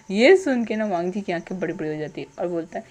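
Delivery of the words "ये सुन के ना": plain reading voice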